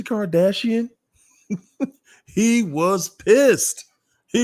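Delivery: high, slow laughing drawl; high amused drawl, slow; laughing disbelief. Real, not acted. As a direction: stretch it out through laughter. Laughing at Kardashian's face.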